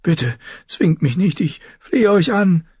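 high, begging plea; pleading girl. A narrator's reading. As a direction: beg him not to force you. Sansa begs the king. (hohes, flehendes Bitten)

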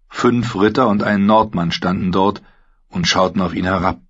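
ordinary delivery

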